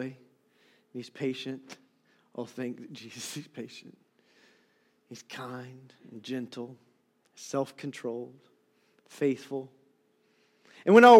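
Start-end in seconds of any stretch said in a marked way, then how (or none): 0.0-9.7 much softer, hushed closing words; soft intimate preaching. Acted. Pastor softly describing God to his church, hushed. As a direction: draw them in close and tender.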